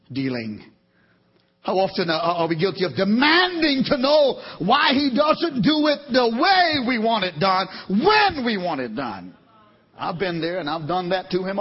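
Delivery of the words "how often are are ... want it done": fervent preaching. Acted. Preaching at full force.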